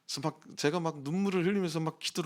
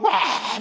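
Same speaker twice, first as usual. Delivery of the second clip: high, loud mock scream; imitated yell; 9 semitones higher, 8 dB louder than usual. Pastor mimicking the screaming man, imitated yell. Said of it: "소리를 지르고".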